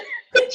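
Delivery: laugh. Real, not acted. Laughing at friend's bad Spanish.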